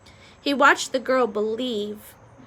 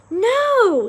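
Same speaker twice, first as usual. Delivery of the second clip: high, loud, urgent "no"; exasperated loud objection; urgent hissed correction; 10 semitones higher, 8 dB louder than usual. A narrator's reading. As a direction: cut in urgently, pitched up and loud. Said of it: "he hissed into the girl's useless ears".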